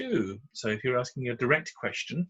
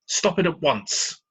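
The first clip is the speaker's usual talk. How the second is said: slow, loud order, pitched higher; stern command; 6 semitones higher, 6 dB louder, slower than usual. Shown as a demonstration. Teacher demonstrating an exclamation to the class, slow and loud. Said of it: "ends with an exclamation mark".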